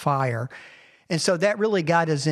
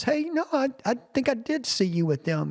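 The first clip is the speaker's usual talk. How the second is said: put-on much higher, childlike voice; little girl; 9 semitones higher than usual. Little girl tells Peter she saw him, high and childlike. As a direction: lift into a little girl's voice. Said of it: "even a little girl says".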